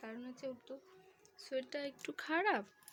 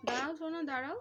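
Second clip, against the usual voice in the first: higher, soothing wait, darling; coaxing wait; 5 semitones higher, 5 dB louder than usual. Real, not acted. Mother telling her son to wait: higher soothing call.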